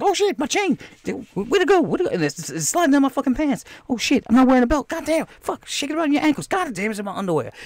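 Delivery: high-pitched comic fluster; mock panic. Fighter whose chain slides down his pants, hammed-up panic. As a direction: squeal it in exaggerated panic. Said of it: "they go into panic and be like".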